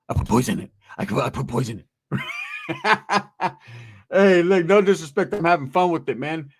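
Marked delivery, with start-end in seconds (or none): 0.1-1.9 low-pitched, creaky mock confession; mock sinister aside. Voices a sinister aside after thanks. 2.1-3.5 high-pitched burst of laughing; amused laughter. Real, not acted. Cracks up at his own bit.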